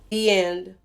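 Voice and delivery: usual speaking voice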